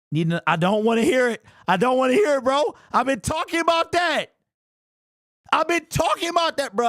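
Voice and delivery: building from annoyed to exploding; exasperated outburst. Real, not acted. Exasperated rant at friend Danny.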